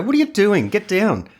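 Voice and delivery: mock teacher calling down a kid; imitated teacher telling off. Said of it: "the teacher would just go".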